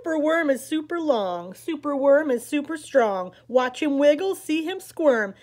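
put-on lively read-aloud for little kids; animated picture-book reading; story narration. A narrator's reading. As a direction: read it like story time on the rug. Reads picture book to children.

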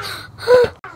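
high imitated gasp; gasping for air. Friend struggling for air after the sting, imitated gasp. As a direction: act out a struggle for breath. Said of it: "breathing for air".

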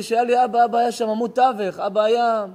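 loud, high, breaking cry; sobbing aloud, voice breaking high; real crying. Real, not acted. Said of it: "מה זה בכיתי?".

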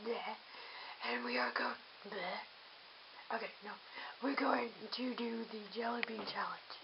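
hushed whisper. Real, not acted. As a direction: whisper it so mom won't hear. Whispering secret plan.

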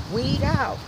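ordinary delivery

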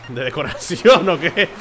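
laughing. Real, not acted. Jokes about shelving the relic.

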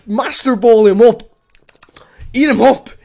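ordinary talk

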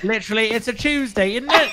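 high, playful mock British; British accent. Shown as a demonstration. Friend joining in the accent game, exaggerated British. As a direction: send up British speech, pitched right up. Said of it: "I love doing, like, accents".

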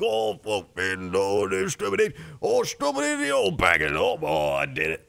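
garbled English workman impression; exaggerated British accent, swooping in pitch; British builder. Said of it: "And he's like".

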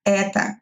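"это" as E-ta, last o turned to a; reduced final vowel. Shown as a demonstration. Teacher repeating the model, "это" with an a ending. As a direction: stress the e of "это", finish on a. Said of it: "kind of like a at the end".

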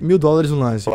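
ordinary talk

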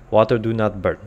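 ordinary talk, nothing marked

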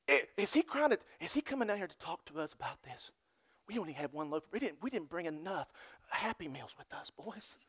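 hushed, mumbled worried disciples. Acted. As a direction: mutter it like anxious followers caught out. Disciples voiced worried, mocking.

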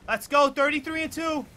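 high, loud hype yells; hyped celebration. Real, not acted. Streamer celebrating his kill count, hyped and loud.

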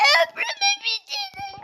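very high, laughing complaint; laughing, high-pitched gripe; laughing exasperation. Real, not acted. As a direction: laugh it out in disbelief.

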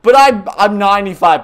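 high, loud complaint; exasperated shout. Real, not acted. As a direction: throw up your hands vocally. Exasperated at baby.

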